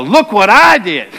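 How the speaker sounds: slow, smug little boast; mock pride. Preacher mocking his own pride after a sermon, mock boast. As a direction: brag about the sermon in a mock way. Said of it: "walk over to my office afterwards and go".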